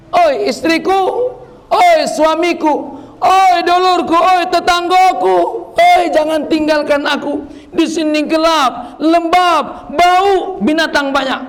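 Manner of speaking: high, drawn-out wailing calls to family; crying out from the grave. Acted. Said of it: "saat itu dia teriak". (ratapan tinggi dan panjang dari dalam kubur)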